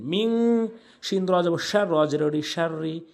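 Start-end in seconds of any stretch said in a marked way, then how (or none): 0.0-0.3 মিং held out nasally; ghunna. Shown as a demonstration. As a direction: sustain the ng of মিং in the nose. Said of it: "গুন্নাহ করে পড়বেন".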